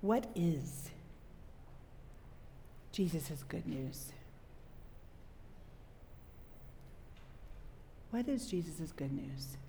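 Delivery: slow, low, nearly whispered question; low hush with weighty pauses. Real, not acted. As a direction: pose it softly and gravely. Solemnly poses the critical question.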